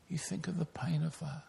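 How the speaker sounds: hushed whisper, letting the pain sink in. Shown as a demonstration. Preacher whispers to the congregation, picturing the pain. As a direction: drop to a breathy whisper.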